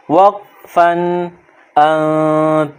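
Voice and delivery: وقفاً, then ان with the final sound silent; stopping on ان. Shown as a demonstration. Teacher showing students how to stop. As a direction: stop on ان and drop the last letter. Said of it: "last والے حرف کو silent کر دیتے ہیں".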